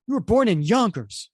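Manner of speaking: high, loud acted accusation; indignant retort. Reader voices a teammate exposing Pioneer's origins, loud retort. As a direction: throw it back at them, loud and high.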